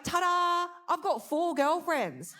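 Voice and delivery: a touch of a showy magician's ta-da; magician reveal. Retold or imitated. Ex boyfriend revealing polyamory to her, mock magician flourish. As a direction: announce it like a cheap magic trick. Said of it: "he revealed it to me like a magician".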